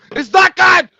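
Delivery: sudden high yell; shouting. Real, not acted. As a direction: blurt it out in a yell. Outburst after sour sip.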